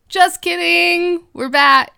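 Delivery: loud, high, drawn-out gag reveal; big jokey comeback, stretched out; playful announcement. Pretend. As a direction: call it out in a mock-triumphant singsong. Fake sign-off, then a playful return.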